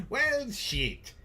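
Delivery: very high hammed-up British accent. Host retrying the commenter's request, high mock British accent. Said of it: "in your best British accent".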